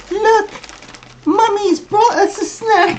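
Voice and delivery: put-on squeaky falsetto child with a botched Scottish accent; bad Scottish accent. Child announcing Mommy's snack, mock Scottish falsetto. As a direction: pipe up very high, hammed-up attempt at Scottish. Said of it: "that was supposed to be Scottish".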